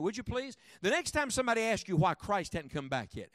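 ordinary delivery

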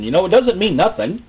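ordinary delivery

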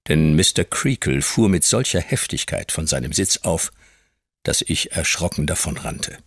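ordinary delivery

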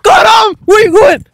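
high, loud triumphant yell; excited shout. Real, not acted. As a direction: shout it out mid-tackle. Tackling in one-on-one.

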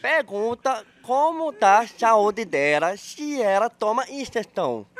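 very slow, word by word; drawn-out, deliberate question; speaking slowly. Shown as a demonstration. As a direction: spell it out slowly for someone who isn't getting it. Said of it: "Eu tenho que falar devagar".

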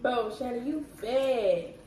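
ordinary talk

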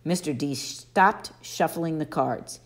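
ordinary delivery

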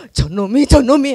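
loud indignant outburst, rising; mother left on the bridge, fuming as her son walks off; betrayed mother. Retold or imitated. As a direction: spit the words after the son.

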